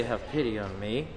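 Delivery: low, soft humble plea; hushed plea for pity. Shown as a demonstration. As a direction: murmur it as a beggar would. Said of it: "they don't say".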